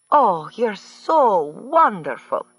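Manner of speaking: slow, high, swooning praise; gushing. A narrator's reading. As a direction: drawl it out, all flattery. Earhart gushing at governor.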